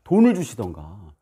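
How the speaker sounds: high, indignant complaint to God; blunt demanding prayer. Retold or imitated. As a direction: re-enact an exasperated, demanding prayer. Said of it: "마구 기도했습니다".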